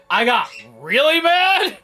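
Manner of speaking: loud, high, drawn-out exclamation; mock-outraged outburst. Real, not acted. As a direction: blurt it out high and loud, stretching the words. Ranting about the ending, then laughs.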